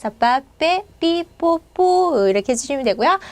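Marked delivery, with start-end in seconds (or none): 0.2-2.3 빠, 빼, 삐, 뽀 as separate tense unaspirated syllables. Shown as a demonstration. Teacher drilling Spanish p, 빠, 빼, 삐, 뽀 with tense consonants. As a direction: pop out 빠, 빼, 삐, 뽀 with a hard Korean double p. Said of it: "된소리 발음으로 뿌 발음해".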